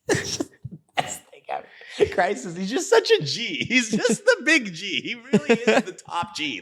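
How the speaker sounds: high, laughing through the words; cracking up mid-story; laughing helplessly at his own story. Real, not acted.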